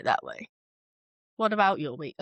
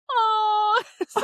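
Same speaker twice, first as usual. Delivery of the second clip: mock comforting coo; 11 semitones higher, 5 dB louder, slower than usual. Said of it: "I'd be like".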